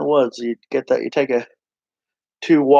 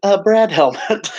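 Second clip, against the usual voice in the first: high, slow, laughing echo; 8 semitones higher, 4 dB louder, slower than usual. Real, not acted. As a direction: say it while cracking up.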